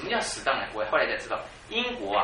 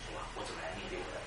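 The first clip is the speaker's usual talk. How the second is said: inner prayer; 10 semitones lower, 13 dB quieter, much faster than usual. Retold or imitated. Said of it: "我就那个时候心里说".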